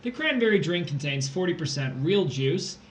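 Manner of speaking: ordinary talk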